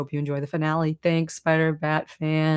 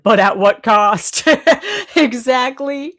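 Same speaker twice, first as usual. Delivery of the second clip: laughing; 7 semitones higher, 7 dB louder than usual. Real, not acted. Joking punchline then laugh.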